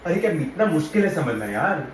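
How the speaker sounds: usual speaking voice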